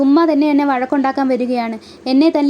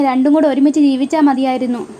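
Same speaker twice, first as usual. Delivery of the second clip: ordinary delivery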